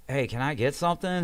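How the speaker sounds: reenacting a patient calling for attention; insistent calling out. Patient asking staff for pain relief after a refusal, insistent calling out.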